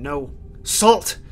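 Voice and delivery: ordinary talk, nothing marked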